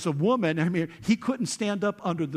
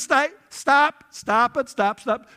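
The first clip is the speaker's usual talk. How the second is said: high, loud commanding stop; Jesus shouting to Peter to stop the sword, high and loud; Jesus crying stop; 9 semitones higher, 9 dB louder, slower than usual. Retold or imitated. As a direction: shout stop to halt the fight.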